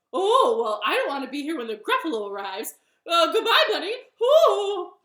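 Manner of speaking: loud, high, rushed owl voice; panicked owl. Acted. Owl flees before Gruffalo arrives, panicked and loud. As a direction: gabble a hammed-up escape, ending in a hoot.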